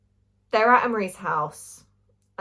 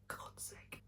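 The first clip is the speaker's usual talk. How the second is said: whisper; 29 dB quieter than usual. Real, not acted. Exasperated aside about the characters.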